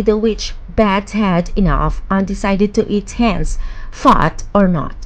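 ordinary delivery

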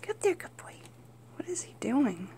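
hushed whisper so as not to startle him; quiet whispered coaxing behind the camera. Real, not acted. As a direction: whisper softly, curious. Whispering while filming the pet.

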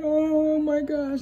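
high, slow, drawn-out moan; mock crying wail. Retelling herself sitting there crying, drawn-out mock wail. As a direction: wail it long and high, imitating your crying self. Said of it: "I'm sitting here crying".

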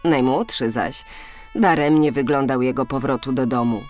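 plain reading voice

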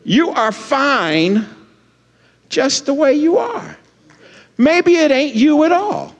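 high, emphatic voice of the Lord. Retold or imitated. The Lord telling a fretting pastor to hush, emphatic. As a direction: imitate God firmly reassuring, phrases set apart. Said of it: "the Lord spoke to me emphatically".

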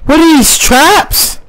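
very high, slow alarmed cry; panicked. Real, not acted. Player spots traps, very high alarmed cry.

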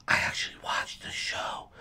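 unvoiced conspiratorial whisper. Real, not acted. Telling viewers a secret aside, in a whisper. Aside about watching live.

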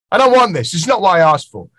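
imitating a blunt New Yorker sending food back; blunt complaint. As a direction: call it out like a brash customer. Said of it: "We'll go".

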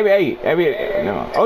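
normal talking voice

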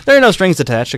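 lightly voiced upbeat recruiter; reassuring professor. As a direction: wave off any worry, eager and upbeat. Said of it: "then added".

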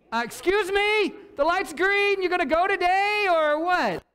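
high, loud mock-polite prod at the driver; impatient nudge. Passenger prodding a stalled driver, sarcastic and loud.

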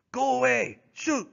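high sharp French-Canadian "Go away!"; Francois driving Buck off, high sharp cry. Acted. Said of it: "he cried".

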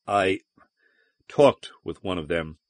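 halting, with a long pause after "I"; slow reply. A narrator's reading. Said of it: "he replied slowly".

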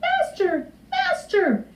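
very high little harp voice; harp calling out. A narrator's reading. Stolen harp calls to the sleeping giant, high-pitched cry. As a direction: call out in a thin high pitch, not loud. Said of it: "the harp called out loudly".

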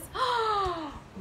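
high, drawn-out gasp; wide, wondering intake of breath; amazed gasp. Acted. Cowboy opens his present.

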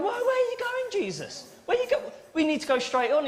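alarmed backseat driver. Acted. Panicked backseat driver questioning Jesus.

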